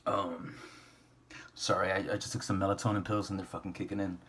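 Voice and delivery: ordinary talk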